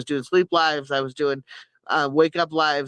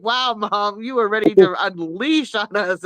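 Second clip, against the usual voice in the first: higher, teasing daughter voice; 4 semitones higher, slower than usual. Retold or imitated. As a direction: tease Mom for nearly exploding. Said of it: "She's like".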